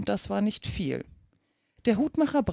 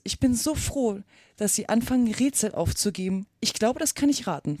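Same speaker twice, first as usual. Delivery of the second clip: ordinary delivery, nothing marked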